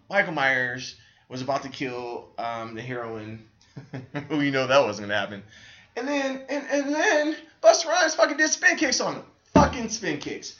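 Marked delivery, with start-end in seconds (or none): 6.0-10.6 much higher and louder, voice cracking in outrage; almost crying at how absurd it is; disbelieving outcry. Real, not acted. Ranting about the movie.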